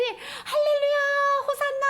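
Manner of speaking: high-pitched cheering crowd; acted crowd hailing a king; jubilant welcome. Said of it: "막 환영을 했어요".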